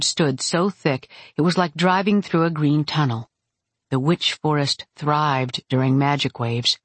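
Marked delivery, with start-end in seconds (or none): none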